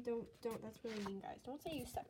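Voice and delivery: usual speaking voice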